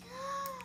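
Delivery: a sharp high gasp of alarm; shocked gasp. Acted. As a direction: gasp in fright. Crowd gasps at the news.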